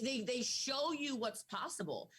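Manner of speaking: usual speaking voice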